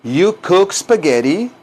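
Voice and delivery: measured teaching delivery with rising end; repeat-after-me model. Shown as a demonstration. Teacher models the translation for students, measured, rising. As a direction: say it carefully for learners to echo.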